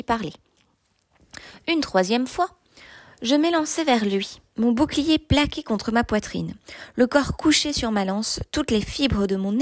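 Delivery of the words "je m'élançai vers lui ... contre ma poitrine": usual speaking voice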